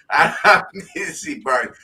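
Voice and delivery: says it again through a laugh; laughing insistence. Real, not acted. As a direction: repeat it, laughing.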